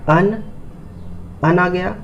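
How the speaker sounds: usual speaking voice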